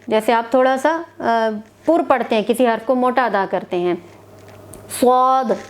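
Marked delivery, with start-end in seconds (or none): 5.0-5.6 स्वाद said full-mouthed and thick; heavy swad. Shown as a demonstration. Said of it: "मुंह भर के". (स्वाद पुर, मुंह भर के)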